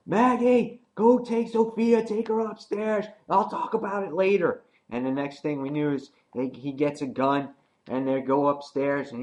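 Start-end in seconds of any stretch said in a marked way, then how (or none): 0.1-4.6 much higher, urgent orders to Maggie; urgent Glenn. Retold or imitated. Glenn sending Maggie upstairs, much higher, urgent. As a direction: hurry her upstairs. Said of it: "Glenn's like".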